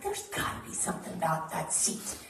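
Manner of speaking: hushed, unvoiced aside to himself; curious whisper. Fan in the nosebleeds whispering about the empty seat. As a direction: whisper it like a secret plan. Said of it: "And he's like".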